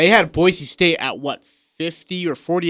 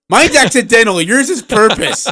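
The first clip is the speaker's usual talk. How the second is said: laughing protest; 7 semitones higher, 5 dB louder than usual. Real, not acted. Laughing accusation.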